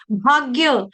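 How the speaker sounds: ordinary talk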